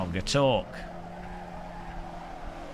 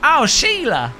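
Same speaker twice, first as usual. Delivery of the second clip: high-pitched, hammed-up Aussie parent; bad Australian accent; 9 semitones higher, 3 dB louder than usual. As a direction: put on a terrible Australian accent, high and loud. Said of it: "this is the worst Australian accent I've ever tried".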